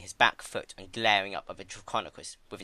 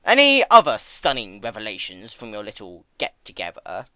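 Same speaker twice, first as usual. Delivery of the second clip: sardonic Discord; 10 dB louder than usual. Acted.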